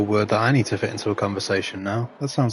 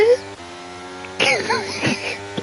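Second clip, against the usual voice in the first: breathless laugh. Real, not acted. Breathless laugh after crash.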